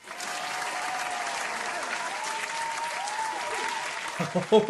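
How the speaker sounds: high, hammed-up showman cackle; hammy laugh. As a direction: laugh big and theatrical. Host cackles at the new set.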